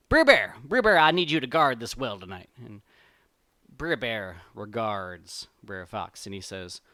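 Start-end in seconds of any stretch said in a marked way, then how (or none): 0.1-2.5 loud, raised call to summon someone; storyteller's fox calling loudly. Acted. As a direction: holler for the bear, then give the order. Fox calls out to bear.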